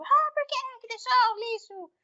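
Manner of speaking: high-pitched mock scolding; lightly hammed-up irritated nag; mock angry nag. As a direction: imitate your old nagging self. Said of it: "eu já teria gritado".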